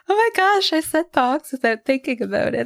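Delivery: high-pitched delighted realisation; retold thrill, voice jumping up; proud excitement. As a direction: gush with pride at yourself. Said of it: "I'm so proud".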